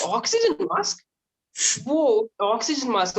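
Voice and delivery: high, wide-eyed mock wonder; amazed. Patient marvels at the oxygen mask, mock amazed. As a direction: gasp it out like a kid seeing a toy.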